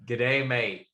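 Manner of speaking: a mild, amateur Aussie greeting; Australian accent. Acted. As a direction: try a half-hearted Australian accent. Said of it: "I'm terrible with accents".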